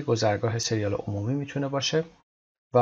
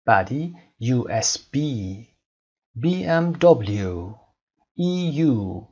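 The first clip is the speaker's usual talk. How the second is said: slow letter by letter, last letter hit; «سی» در «بی بی سی» stressed at the end; final letter stressed; slower than usual. Shown as a demonstration. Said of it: "حرف آخر رو استرس بذارید". (تلفظ آهسته با تکیه روی حرف آخر)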